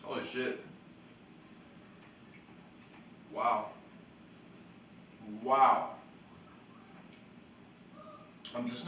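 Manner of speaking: chewing while talking; eating between words; mouth full. Real, not acted. Seen 0: Seated man holds food, hand at mouth; mouth is small in frame.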